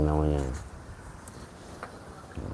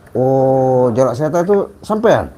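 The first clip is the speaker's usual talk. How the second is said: surprised; 8 semitones higher, 7 dB louder than usual. Real, not acted. Host learns the name's owner.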